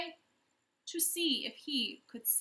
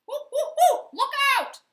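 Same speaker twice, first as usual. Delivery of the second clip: very high, loud monkey hoots; chimp whoops; 16 dB louder than usual. A narrator's reading. Chimpanzee whoops a warning, high and loud. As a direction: hoot it out like an alarmed chimp. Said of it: "whooped the chimpanzee".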